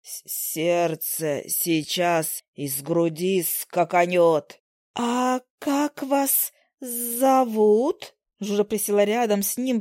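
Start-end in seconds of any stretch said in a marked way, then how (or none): 0.0-4.6 low slow gasping male fly. Acted. Male fly gasps theatrically. 4.9-8.1 slow coy fly. Acted. Fly flirts with male fly.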